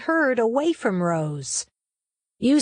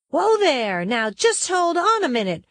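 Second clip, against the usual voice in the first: higher-pitched call to halt the storm; 6 semitones higher than usual. A narrator's reading. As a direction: call out as if ordering the weather to stop. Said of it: "Rose called out to the storm".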